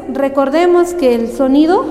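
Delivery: ordinary talk, nothing marked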